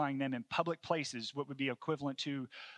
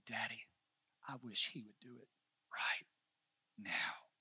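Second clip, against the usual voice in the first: soft, low imitation of a grieving child; subdued retold sorrow, word by word; retold tearful plea; 4 semitones lower, 7 dB quieter than usual. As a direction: quietly voice a small boy's plea, haltingly. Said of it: "crying as hard as he could".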